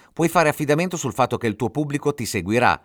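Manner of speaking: usual speaking voice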